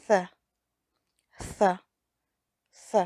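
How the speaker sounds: ث as th, tongue tip between the teeth; Arabic tha sound. Shown as a demonstration. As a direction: push air over the tongue between the teeth for ث.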